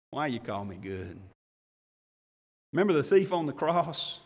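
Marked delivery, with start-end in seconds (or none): 0.1-1.2 much softer, lower and tender; tender, smiling Jesus. Shown as a demonstration. Jesus gently smiling at the kneeling young man, tender. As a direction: say it like a loving smile breaking open. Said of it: "sweet little loving smile".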